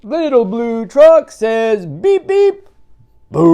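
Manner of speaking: very high, loud beep beep; loud honking boo. Acted. Reader voices the truck's beeping reveal, high and loud. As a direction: honk it out big.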